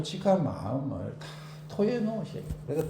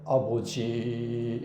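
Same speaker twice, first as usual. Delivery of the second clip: very slow, soft, lower "아버지"; decorous prayer; 5 semitones lower, 5 dB quieter, much slower than usual. Shown as a demonstration. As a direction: pray politely and reserved. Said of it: "점잖게". (점잖게 "아버지")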